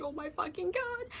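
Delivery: high, strained exclamation; overwhelmed squeal. Retold or imitated. Seeing the tow truck finally come up, high-pitched freak-out.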